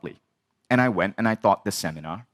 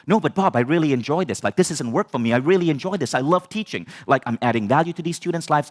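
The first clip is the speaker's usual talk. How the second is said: high, eager protest, reenacted; justifying himself; 5 semitones higher than usual. Student defending his teaching to mentor, pleading and justifying. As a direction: plead your case, pitched up and insistent. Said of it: "I'm trying to justify and justify".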